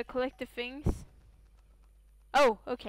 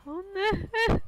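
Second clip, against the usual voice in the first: high, loud frightened plea; being chased; 9 semitones higher, 9 dB louder, much faster than usual. Real, not acted. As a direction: yell the plea in panic. Monster chasing her.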